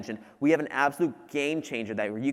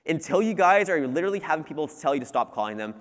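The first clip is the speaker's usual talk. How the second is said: usual speaking voice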